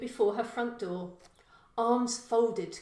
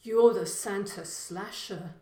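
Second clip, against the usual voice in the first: miserably; 9 dB louder than usual. Acted. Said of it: "he said miserably".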